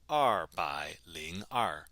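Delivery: slowly, word by word; slow model pronunciation. Shown as a demonstration. Teacher showing how to say two hundred two, slow model pronunciation. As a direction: space out each syllable for learners.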